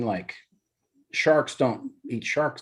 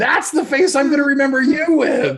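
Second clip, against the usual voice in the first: higher and louder, laughing; 12 semitones higher, 6 dB louder than usual. Real, not acted. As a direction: through a laugh. Friend laughing at the face.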